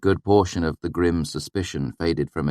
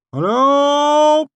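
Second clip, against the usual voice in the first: loud, high shouted greeting; shouting; 9 dB louder than usual. A narrator's reading. Swineherd shouting greeting to strangers, loud and high. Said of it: "he shouted".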